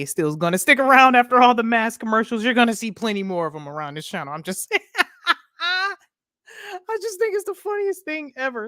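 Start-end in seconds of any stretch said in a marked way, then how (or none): 4.8-5.3 high giggle. Real, not acted. Laughing at mask commercials. 5.6-8.7 talking through high, teary laughter; laughing. Real, not acted. Streamer cracking up at the mask commercials, laughing.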